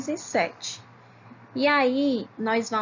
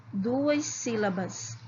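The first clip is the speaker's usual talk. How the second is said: normal talking voice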